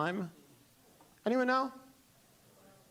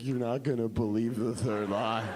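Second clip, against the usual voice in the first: very low, suspenseful voice; teasing reveal; 9 semitones lower than usual. Retold or imitated. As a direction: tease it in a deep tone. Songwriter teases her reveal.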